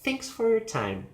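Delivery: high "Thanks", down to "time"; finished fall on "time"; falling statement. Shown as a demonstration. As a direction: stress "Thanks", then let pitch drop. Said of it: "they use falling intonation".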